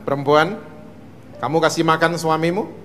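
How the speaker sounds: low, measured divine questioning; God questioning. Acted. God confronting Eve, low and grave. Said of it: "Tuhan datengin Hawa".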